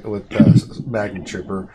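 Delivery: normal talking voice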